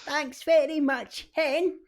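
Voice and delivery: put-on high, slow elderly lady voice on the phone; old pensioner voice. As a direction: thank him like a sweet old granny, drawn out. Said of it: "pensioners on the phone going".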